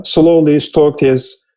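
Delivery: ordinary talk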